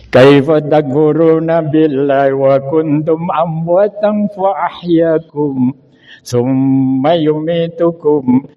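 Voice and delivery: slow, drawn-out chanted recitation; Quran recitation. Acted. Preacher reciting scripture to congregation, slow chant. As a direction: intone the Arabic verse slowly and reverently.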